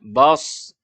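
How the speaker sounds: BAS ending in a heavy s; thick sod. Shown as a demonstration. Teacher lets learners feel the heaviness, thick sod. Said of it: "terasa tebal".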